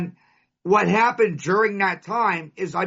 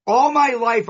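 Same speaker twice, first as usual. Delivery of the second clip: emphatic call; 6 semitones higher, 6 dB louder than usual. Real, not acted. Speaker punches opening phrase again.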